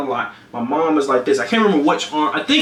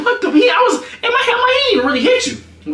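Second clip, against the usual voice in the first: indignant. Retold or imitated. Retelling his indignant reaction.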